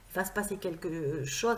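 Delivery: ordinary talk, nothing marked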